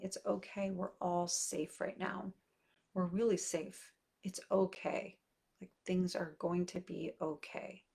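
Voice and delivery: soft, quiet reassurance with hand on heart; calm yourself down gently, softly; soothing self-talk. Shown as a demonstration. Mom soothes herself, hand on heart, in a soft calming voice. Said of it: "soothing self-talk messages".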